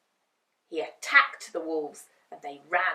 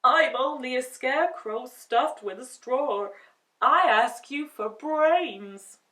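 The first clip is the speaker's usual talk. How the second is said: a hint of a pleading scarecrow; 5 semitones higher, 7 dB louder than usual. A narrator's reading. Scarecrow asks the lady for brains, lightly pleading. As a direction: plead a little. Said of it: "Scarecrow asks".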